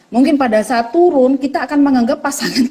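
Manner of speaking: ordinary talk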